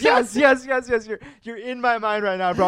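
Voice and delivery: loud, high rapid-fire yeses; excited agreement. Real, not acted. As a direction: jump in, thrilled to be understood. Excitedly agreeing with co-host's interjection.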